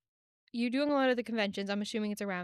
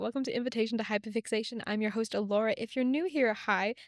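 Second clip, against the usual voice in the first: polished, upbeat podcast host intro; scripted host welcome with lively emphasis; customer service voice. Acted. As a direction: greet listeners in your customer service voice, building to the guest's name. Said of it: "It's my customer service voice".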